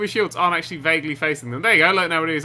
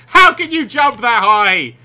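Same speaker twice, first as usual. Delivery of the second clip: loud, high, drawn-out question; incredulous yell; 5 semitones higher, 10 dB louder, slower than usual. Real, not acted. Player amazed by a huge jump, incredulous yell. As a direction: shout it slowly in disbelief.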